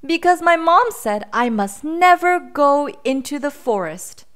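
put-on high, loud storybook child voice; upset little boy. A narrator's reading. Boy tells the bear his mom's forest rule, upset. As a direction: explain it as a worried small boy.

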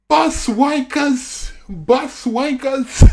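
slow, drawn-out mock jeer; imitating Jay's shout. Fan quoting a car-window heckle, drawn-out mock jeer. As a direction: draw each word out like a hollered taunt. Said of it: "Jay's like".

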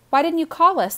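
high, loud complaint; indignant protest. A narrator's reading. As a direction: protest like a kid left out. Kid protests missing the fireworks.

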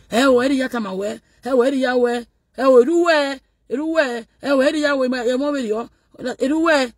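mock him in a put-on high voice; mocking squeaky voice. Mocking put-on voice asking where.

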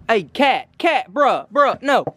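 normal talking voice

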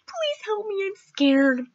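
put-on high, soft pleading child; scared little boy. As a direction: beg for help like a small kid. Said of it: "imagine this cute little boy is like".

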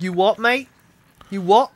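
mock London accent, rising. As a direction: confront someone in a London accent. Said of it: "Like a London British accent".

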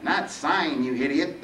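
losing his cool. Acted. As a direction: snap at him in whiny annoyance. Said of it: "the Reaper lost his cool".

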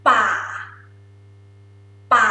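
ป่า said low and level. Shown as a demonstration. Teacher drills low tone for students, low pitch. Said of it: "like, low tone".

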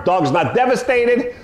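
ordinary delivery

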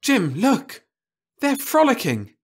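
sudden exclamation. A narrator's reading. Louise excitedly calling Jim to the window. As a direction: point it out in excitement. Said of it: "Louise exclaimed suddenly".

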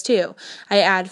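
ordinary delivery, nothing marked

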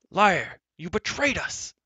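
high, loud furious accusation; furious shout. A narrator's reading. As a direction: scream the betrayal at him. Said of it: "shouted at the top of his voice".

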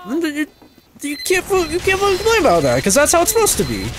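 high, fast exasperated rant. Real, not acted. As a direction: vent your frustration fast. Ranting about complaints.

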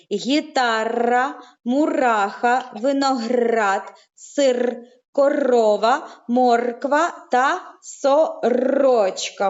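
put-on drawn-out words for children; slow syllable stretching. Shown as a demonstration. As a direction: stretch each word slowly so the kids hear the sounds. Teacher stretches example words slowly. (повільне протяжне вимовляння)

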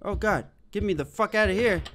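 ordinary talk, nothing marked

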